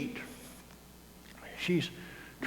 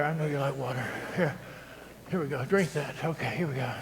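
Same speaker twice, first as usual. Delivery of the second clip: quiet aside; 6 semitones lower, 7 dB quieter, faster than usual. Real, not acted. Aside helping Chris drink water.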